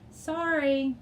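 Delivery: high, loud apology shout; shouted sorry from the canoe; yelled over water. A narrator's reading. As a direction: yell it over the roar of water. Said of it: "Dev yelled over the water".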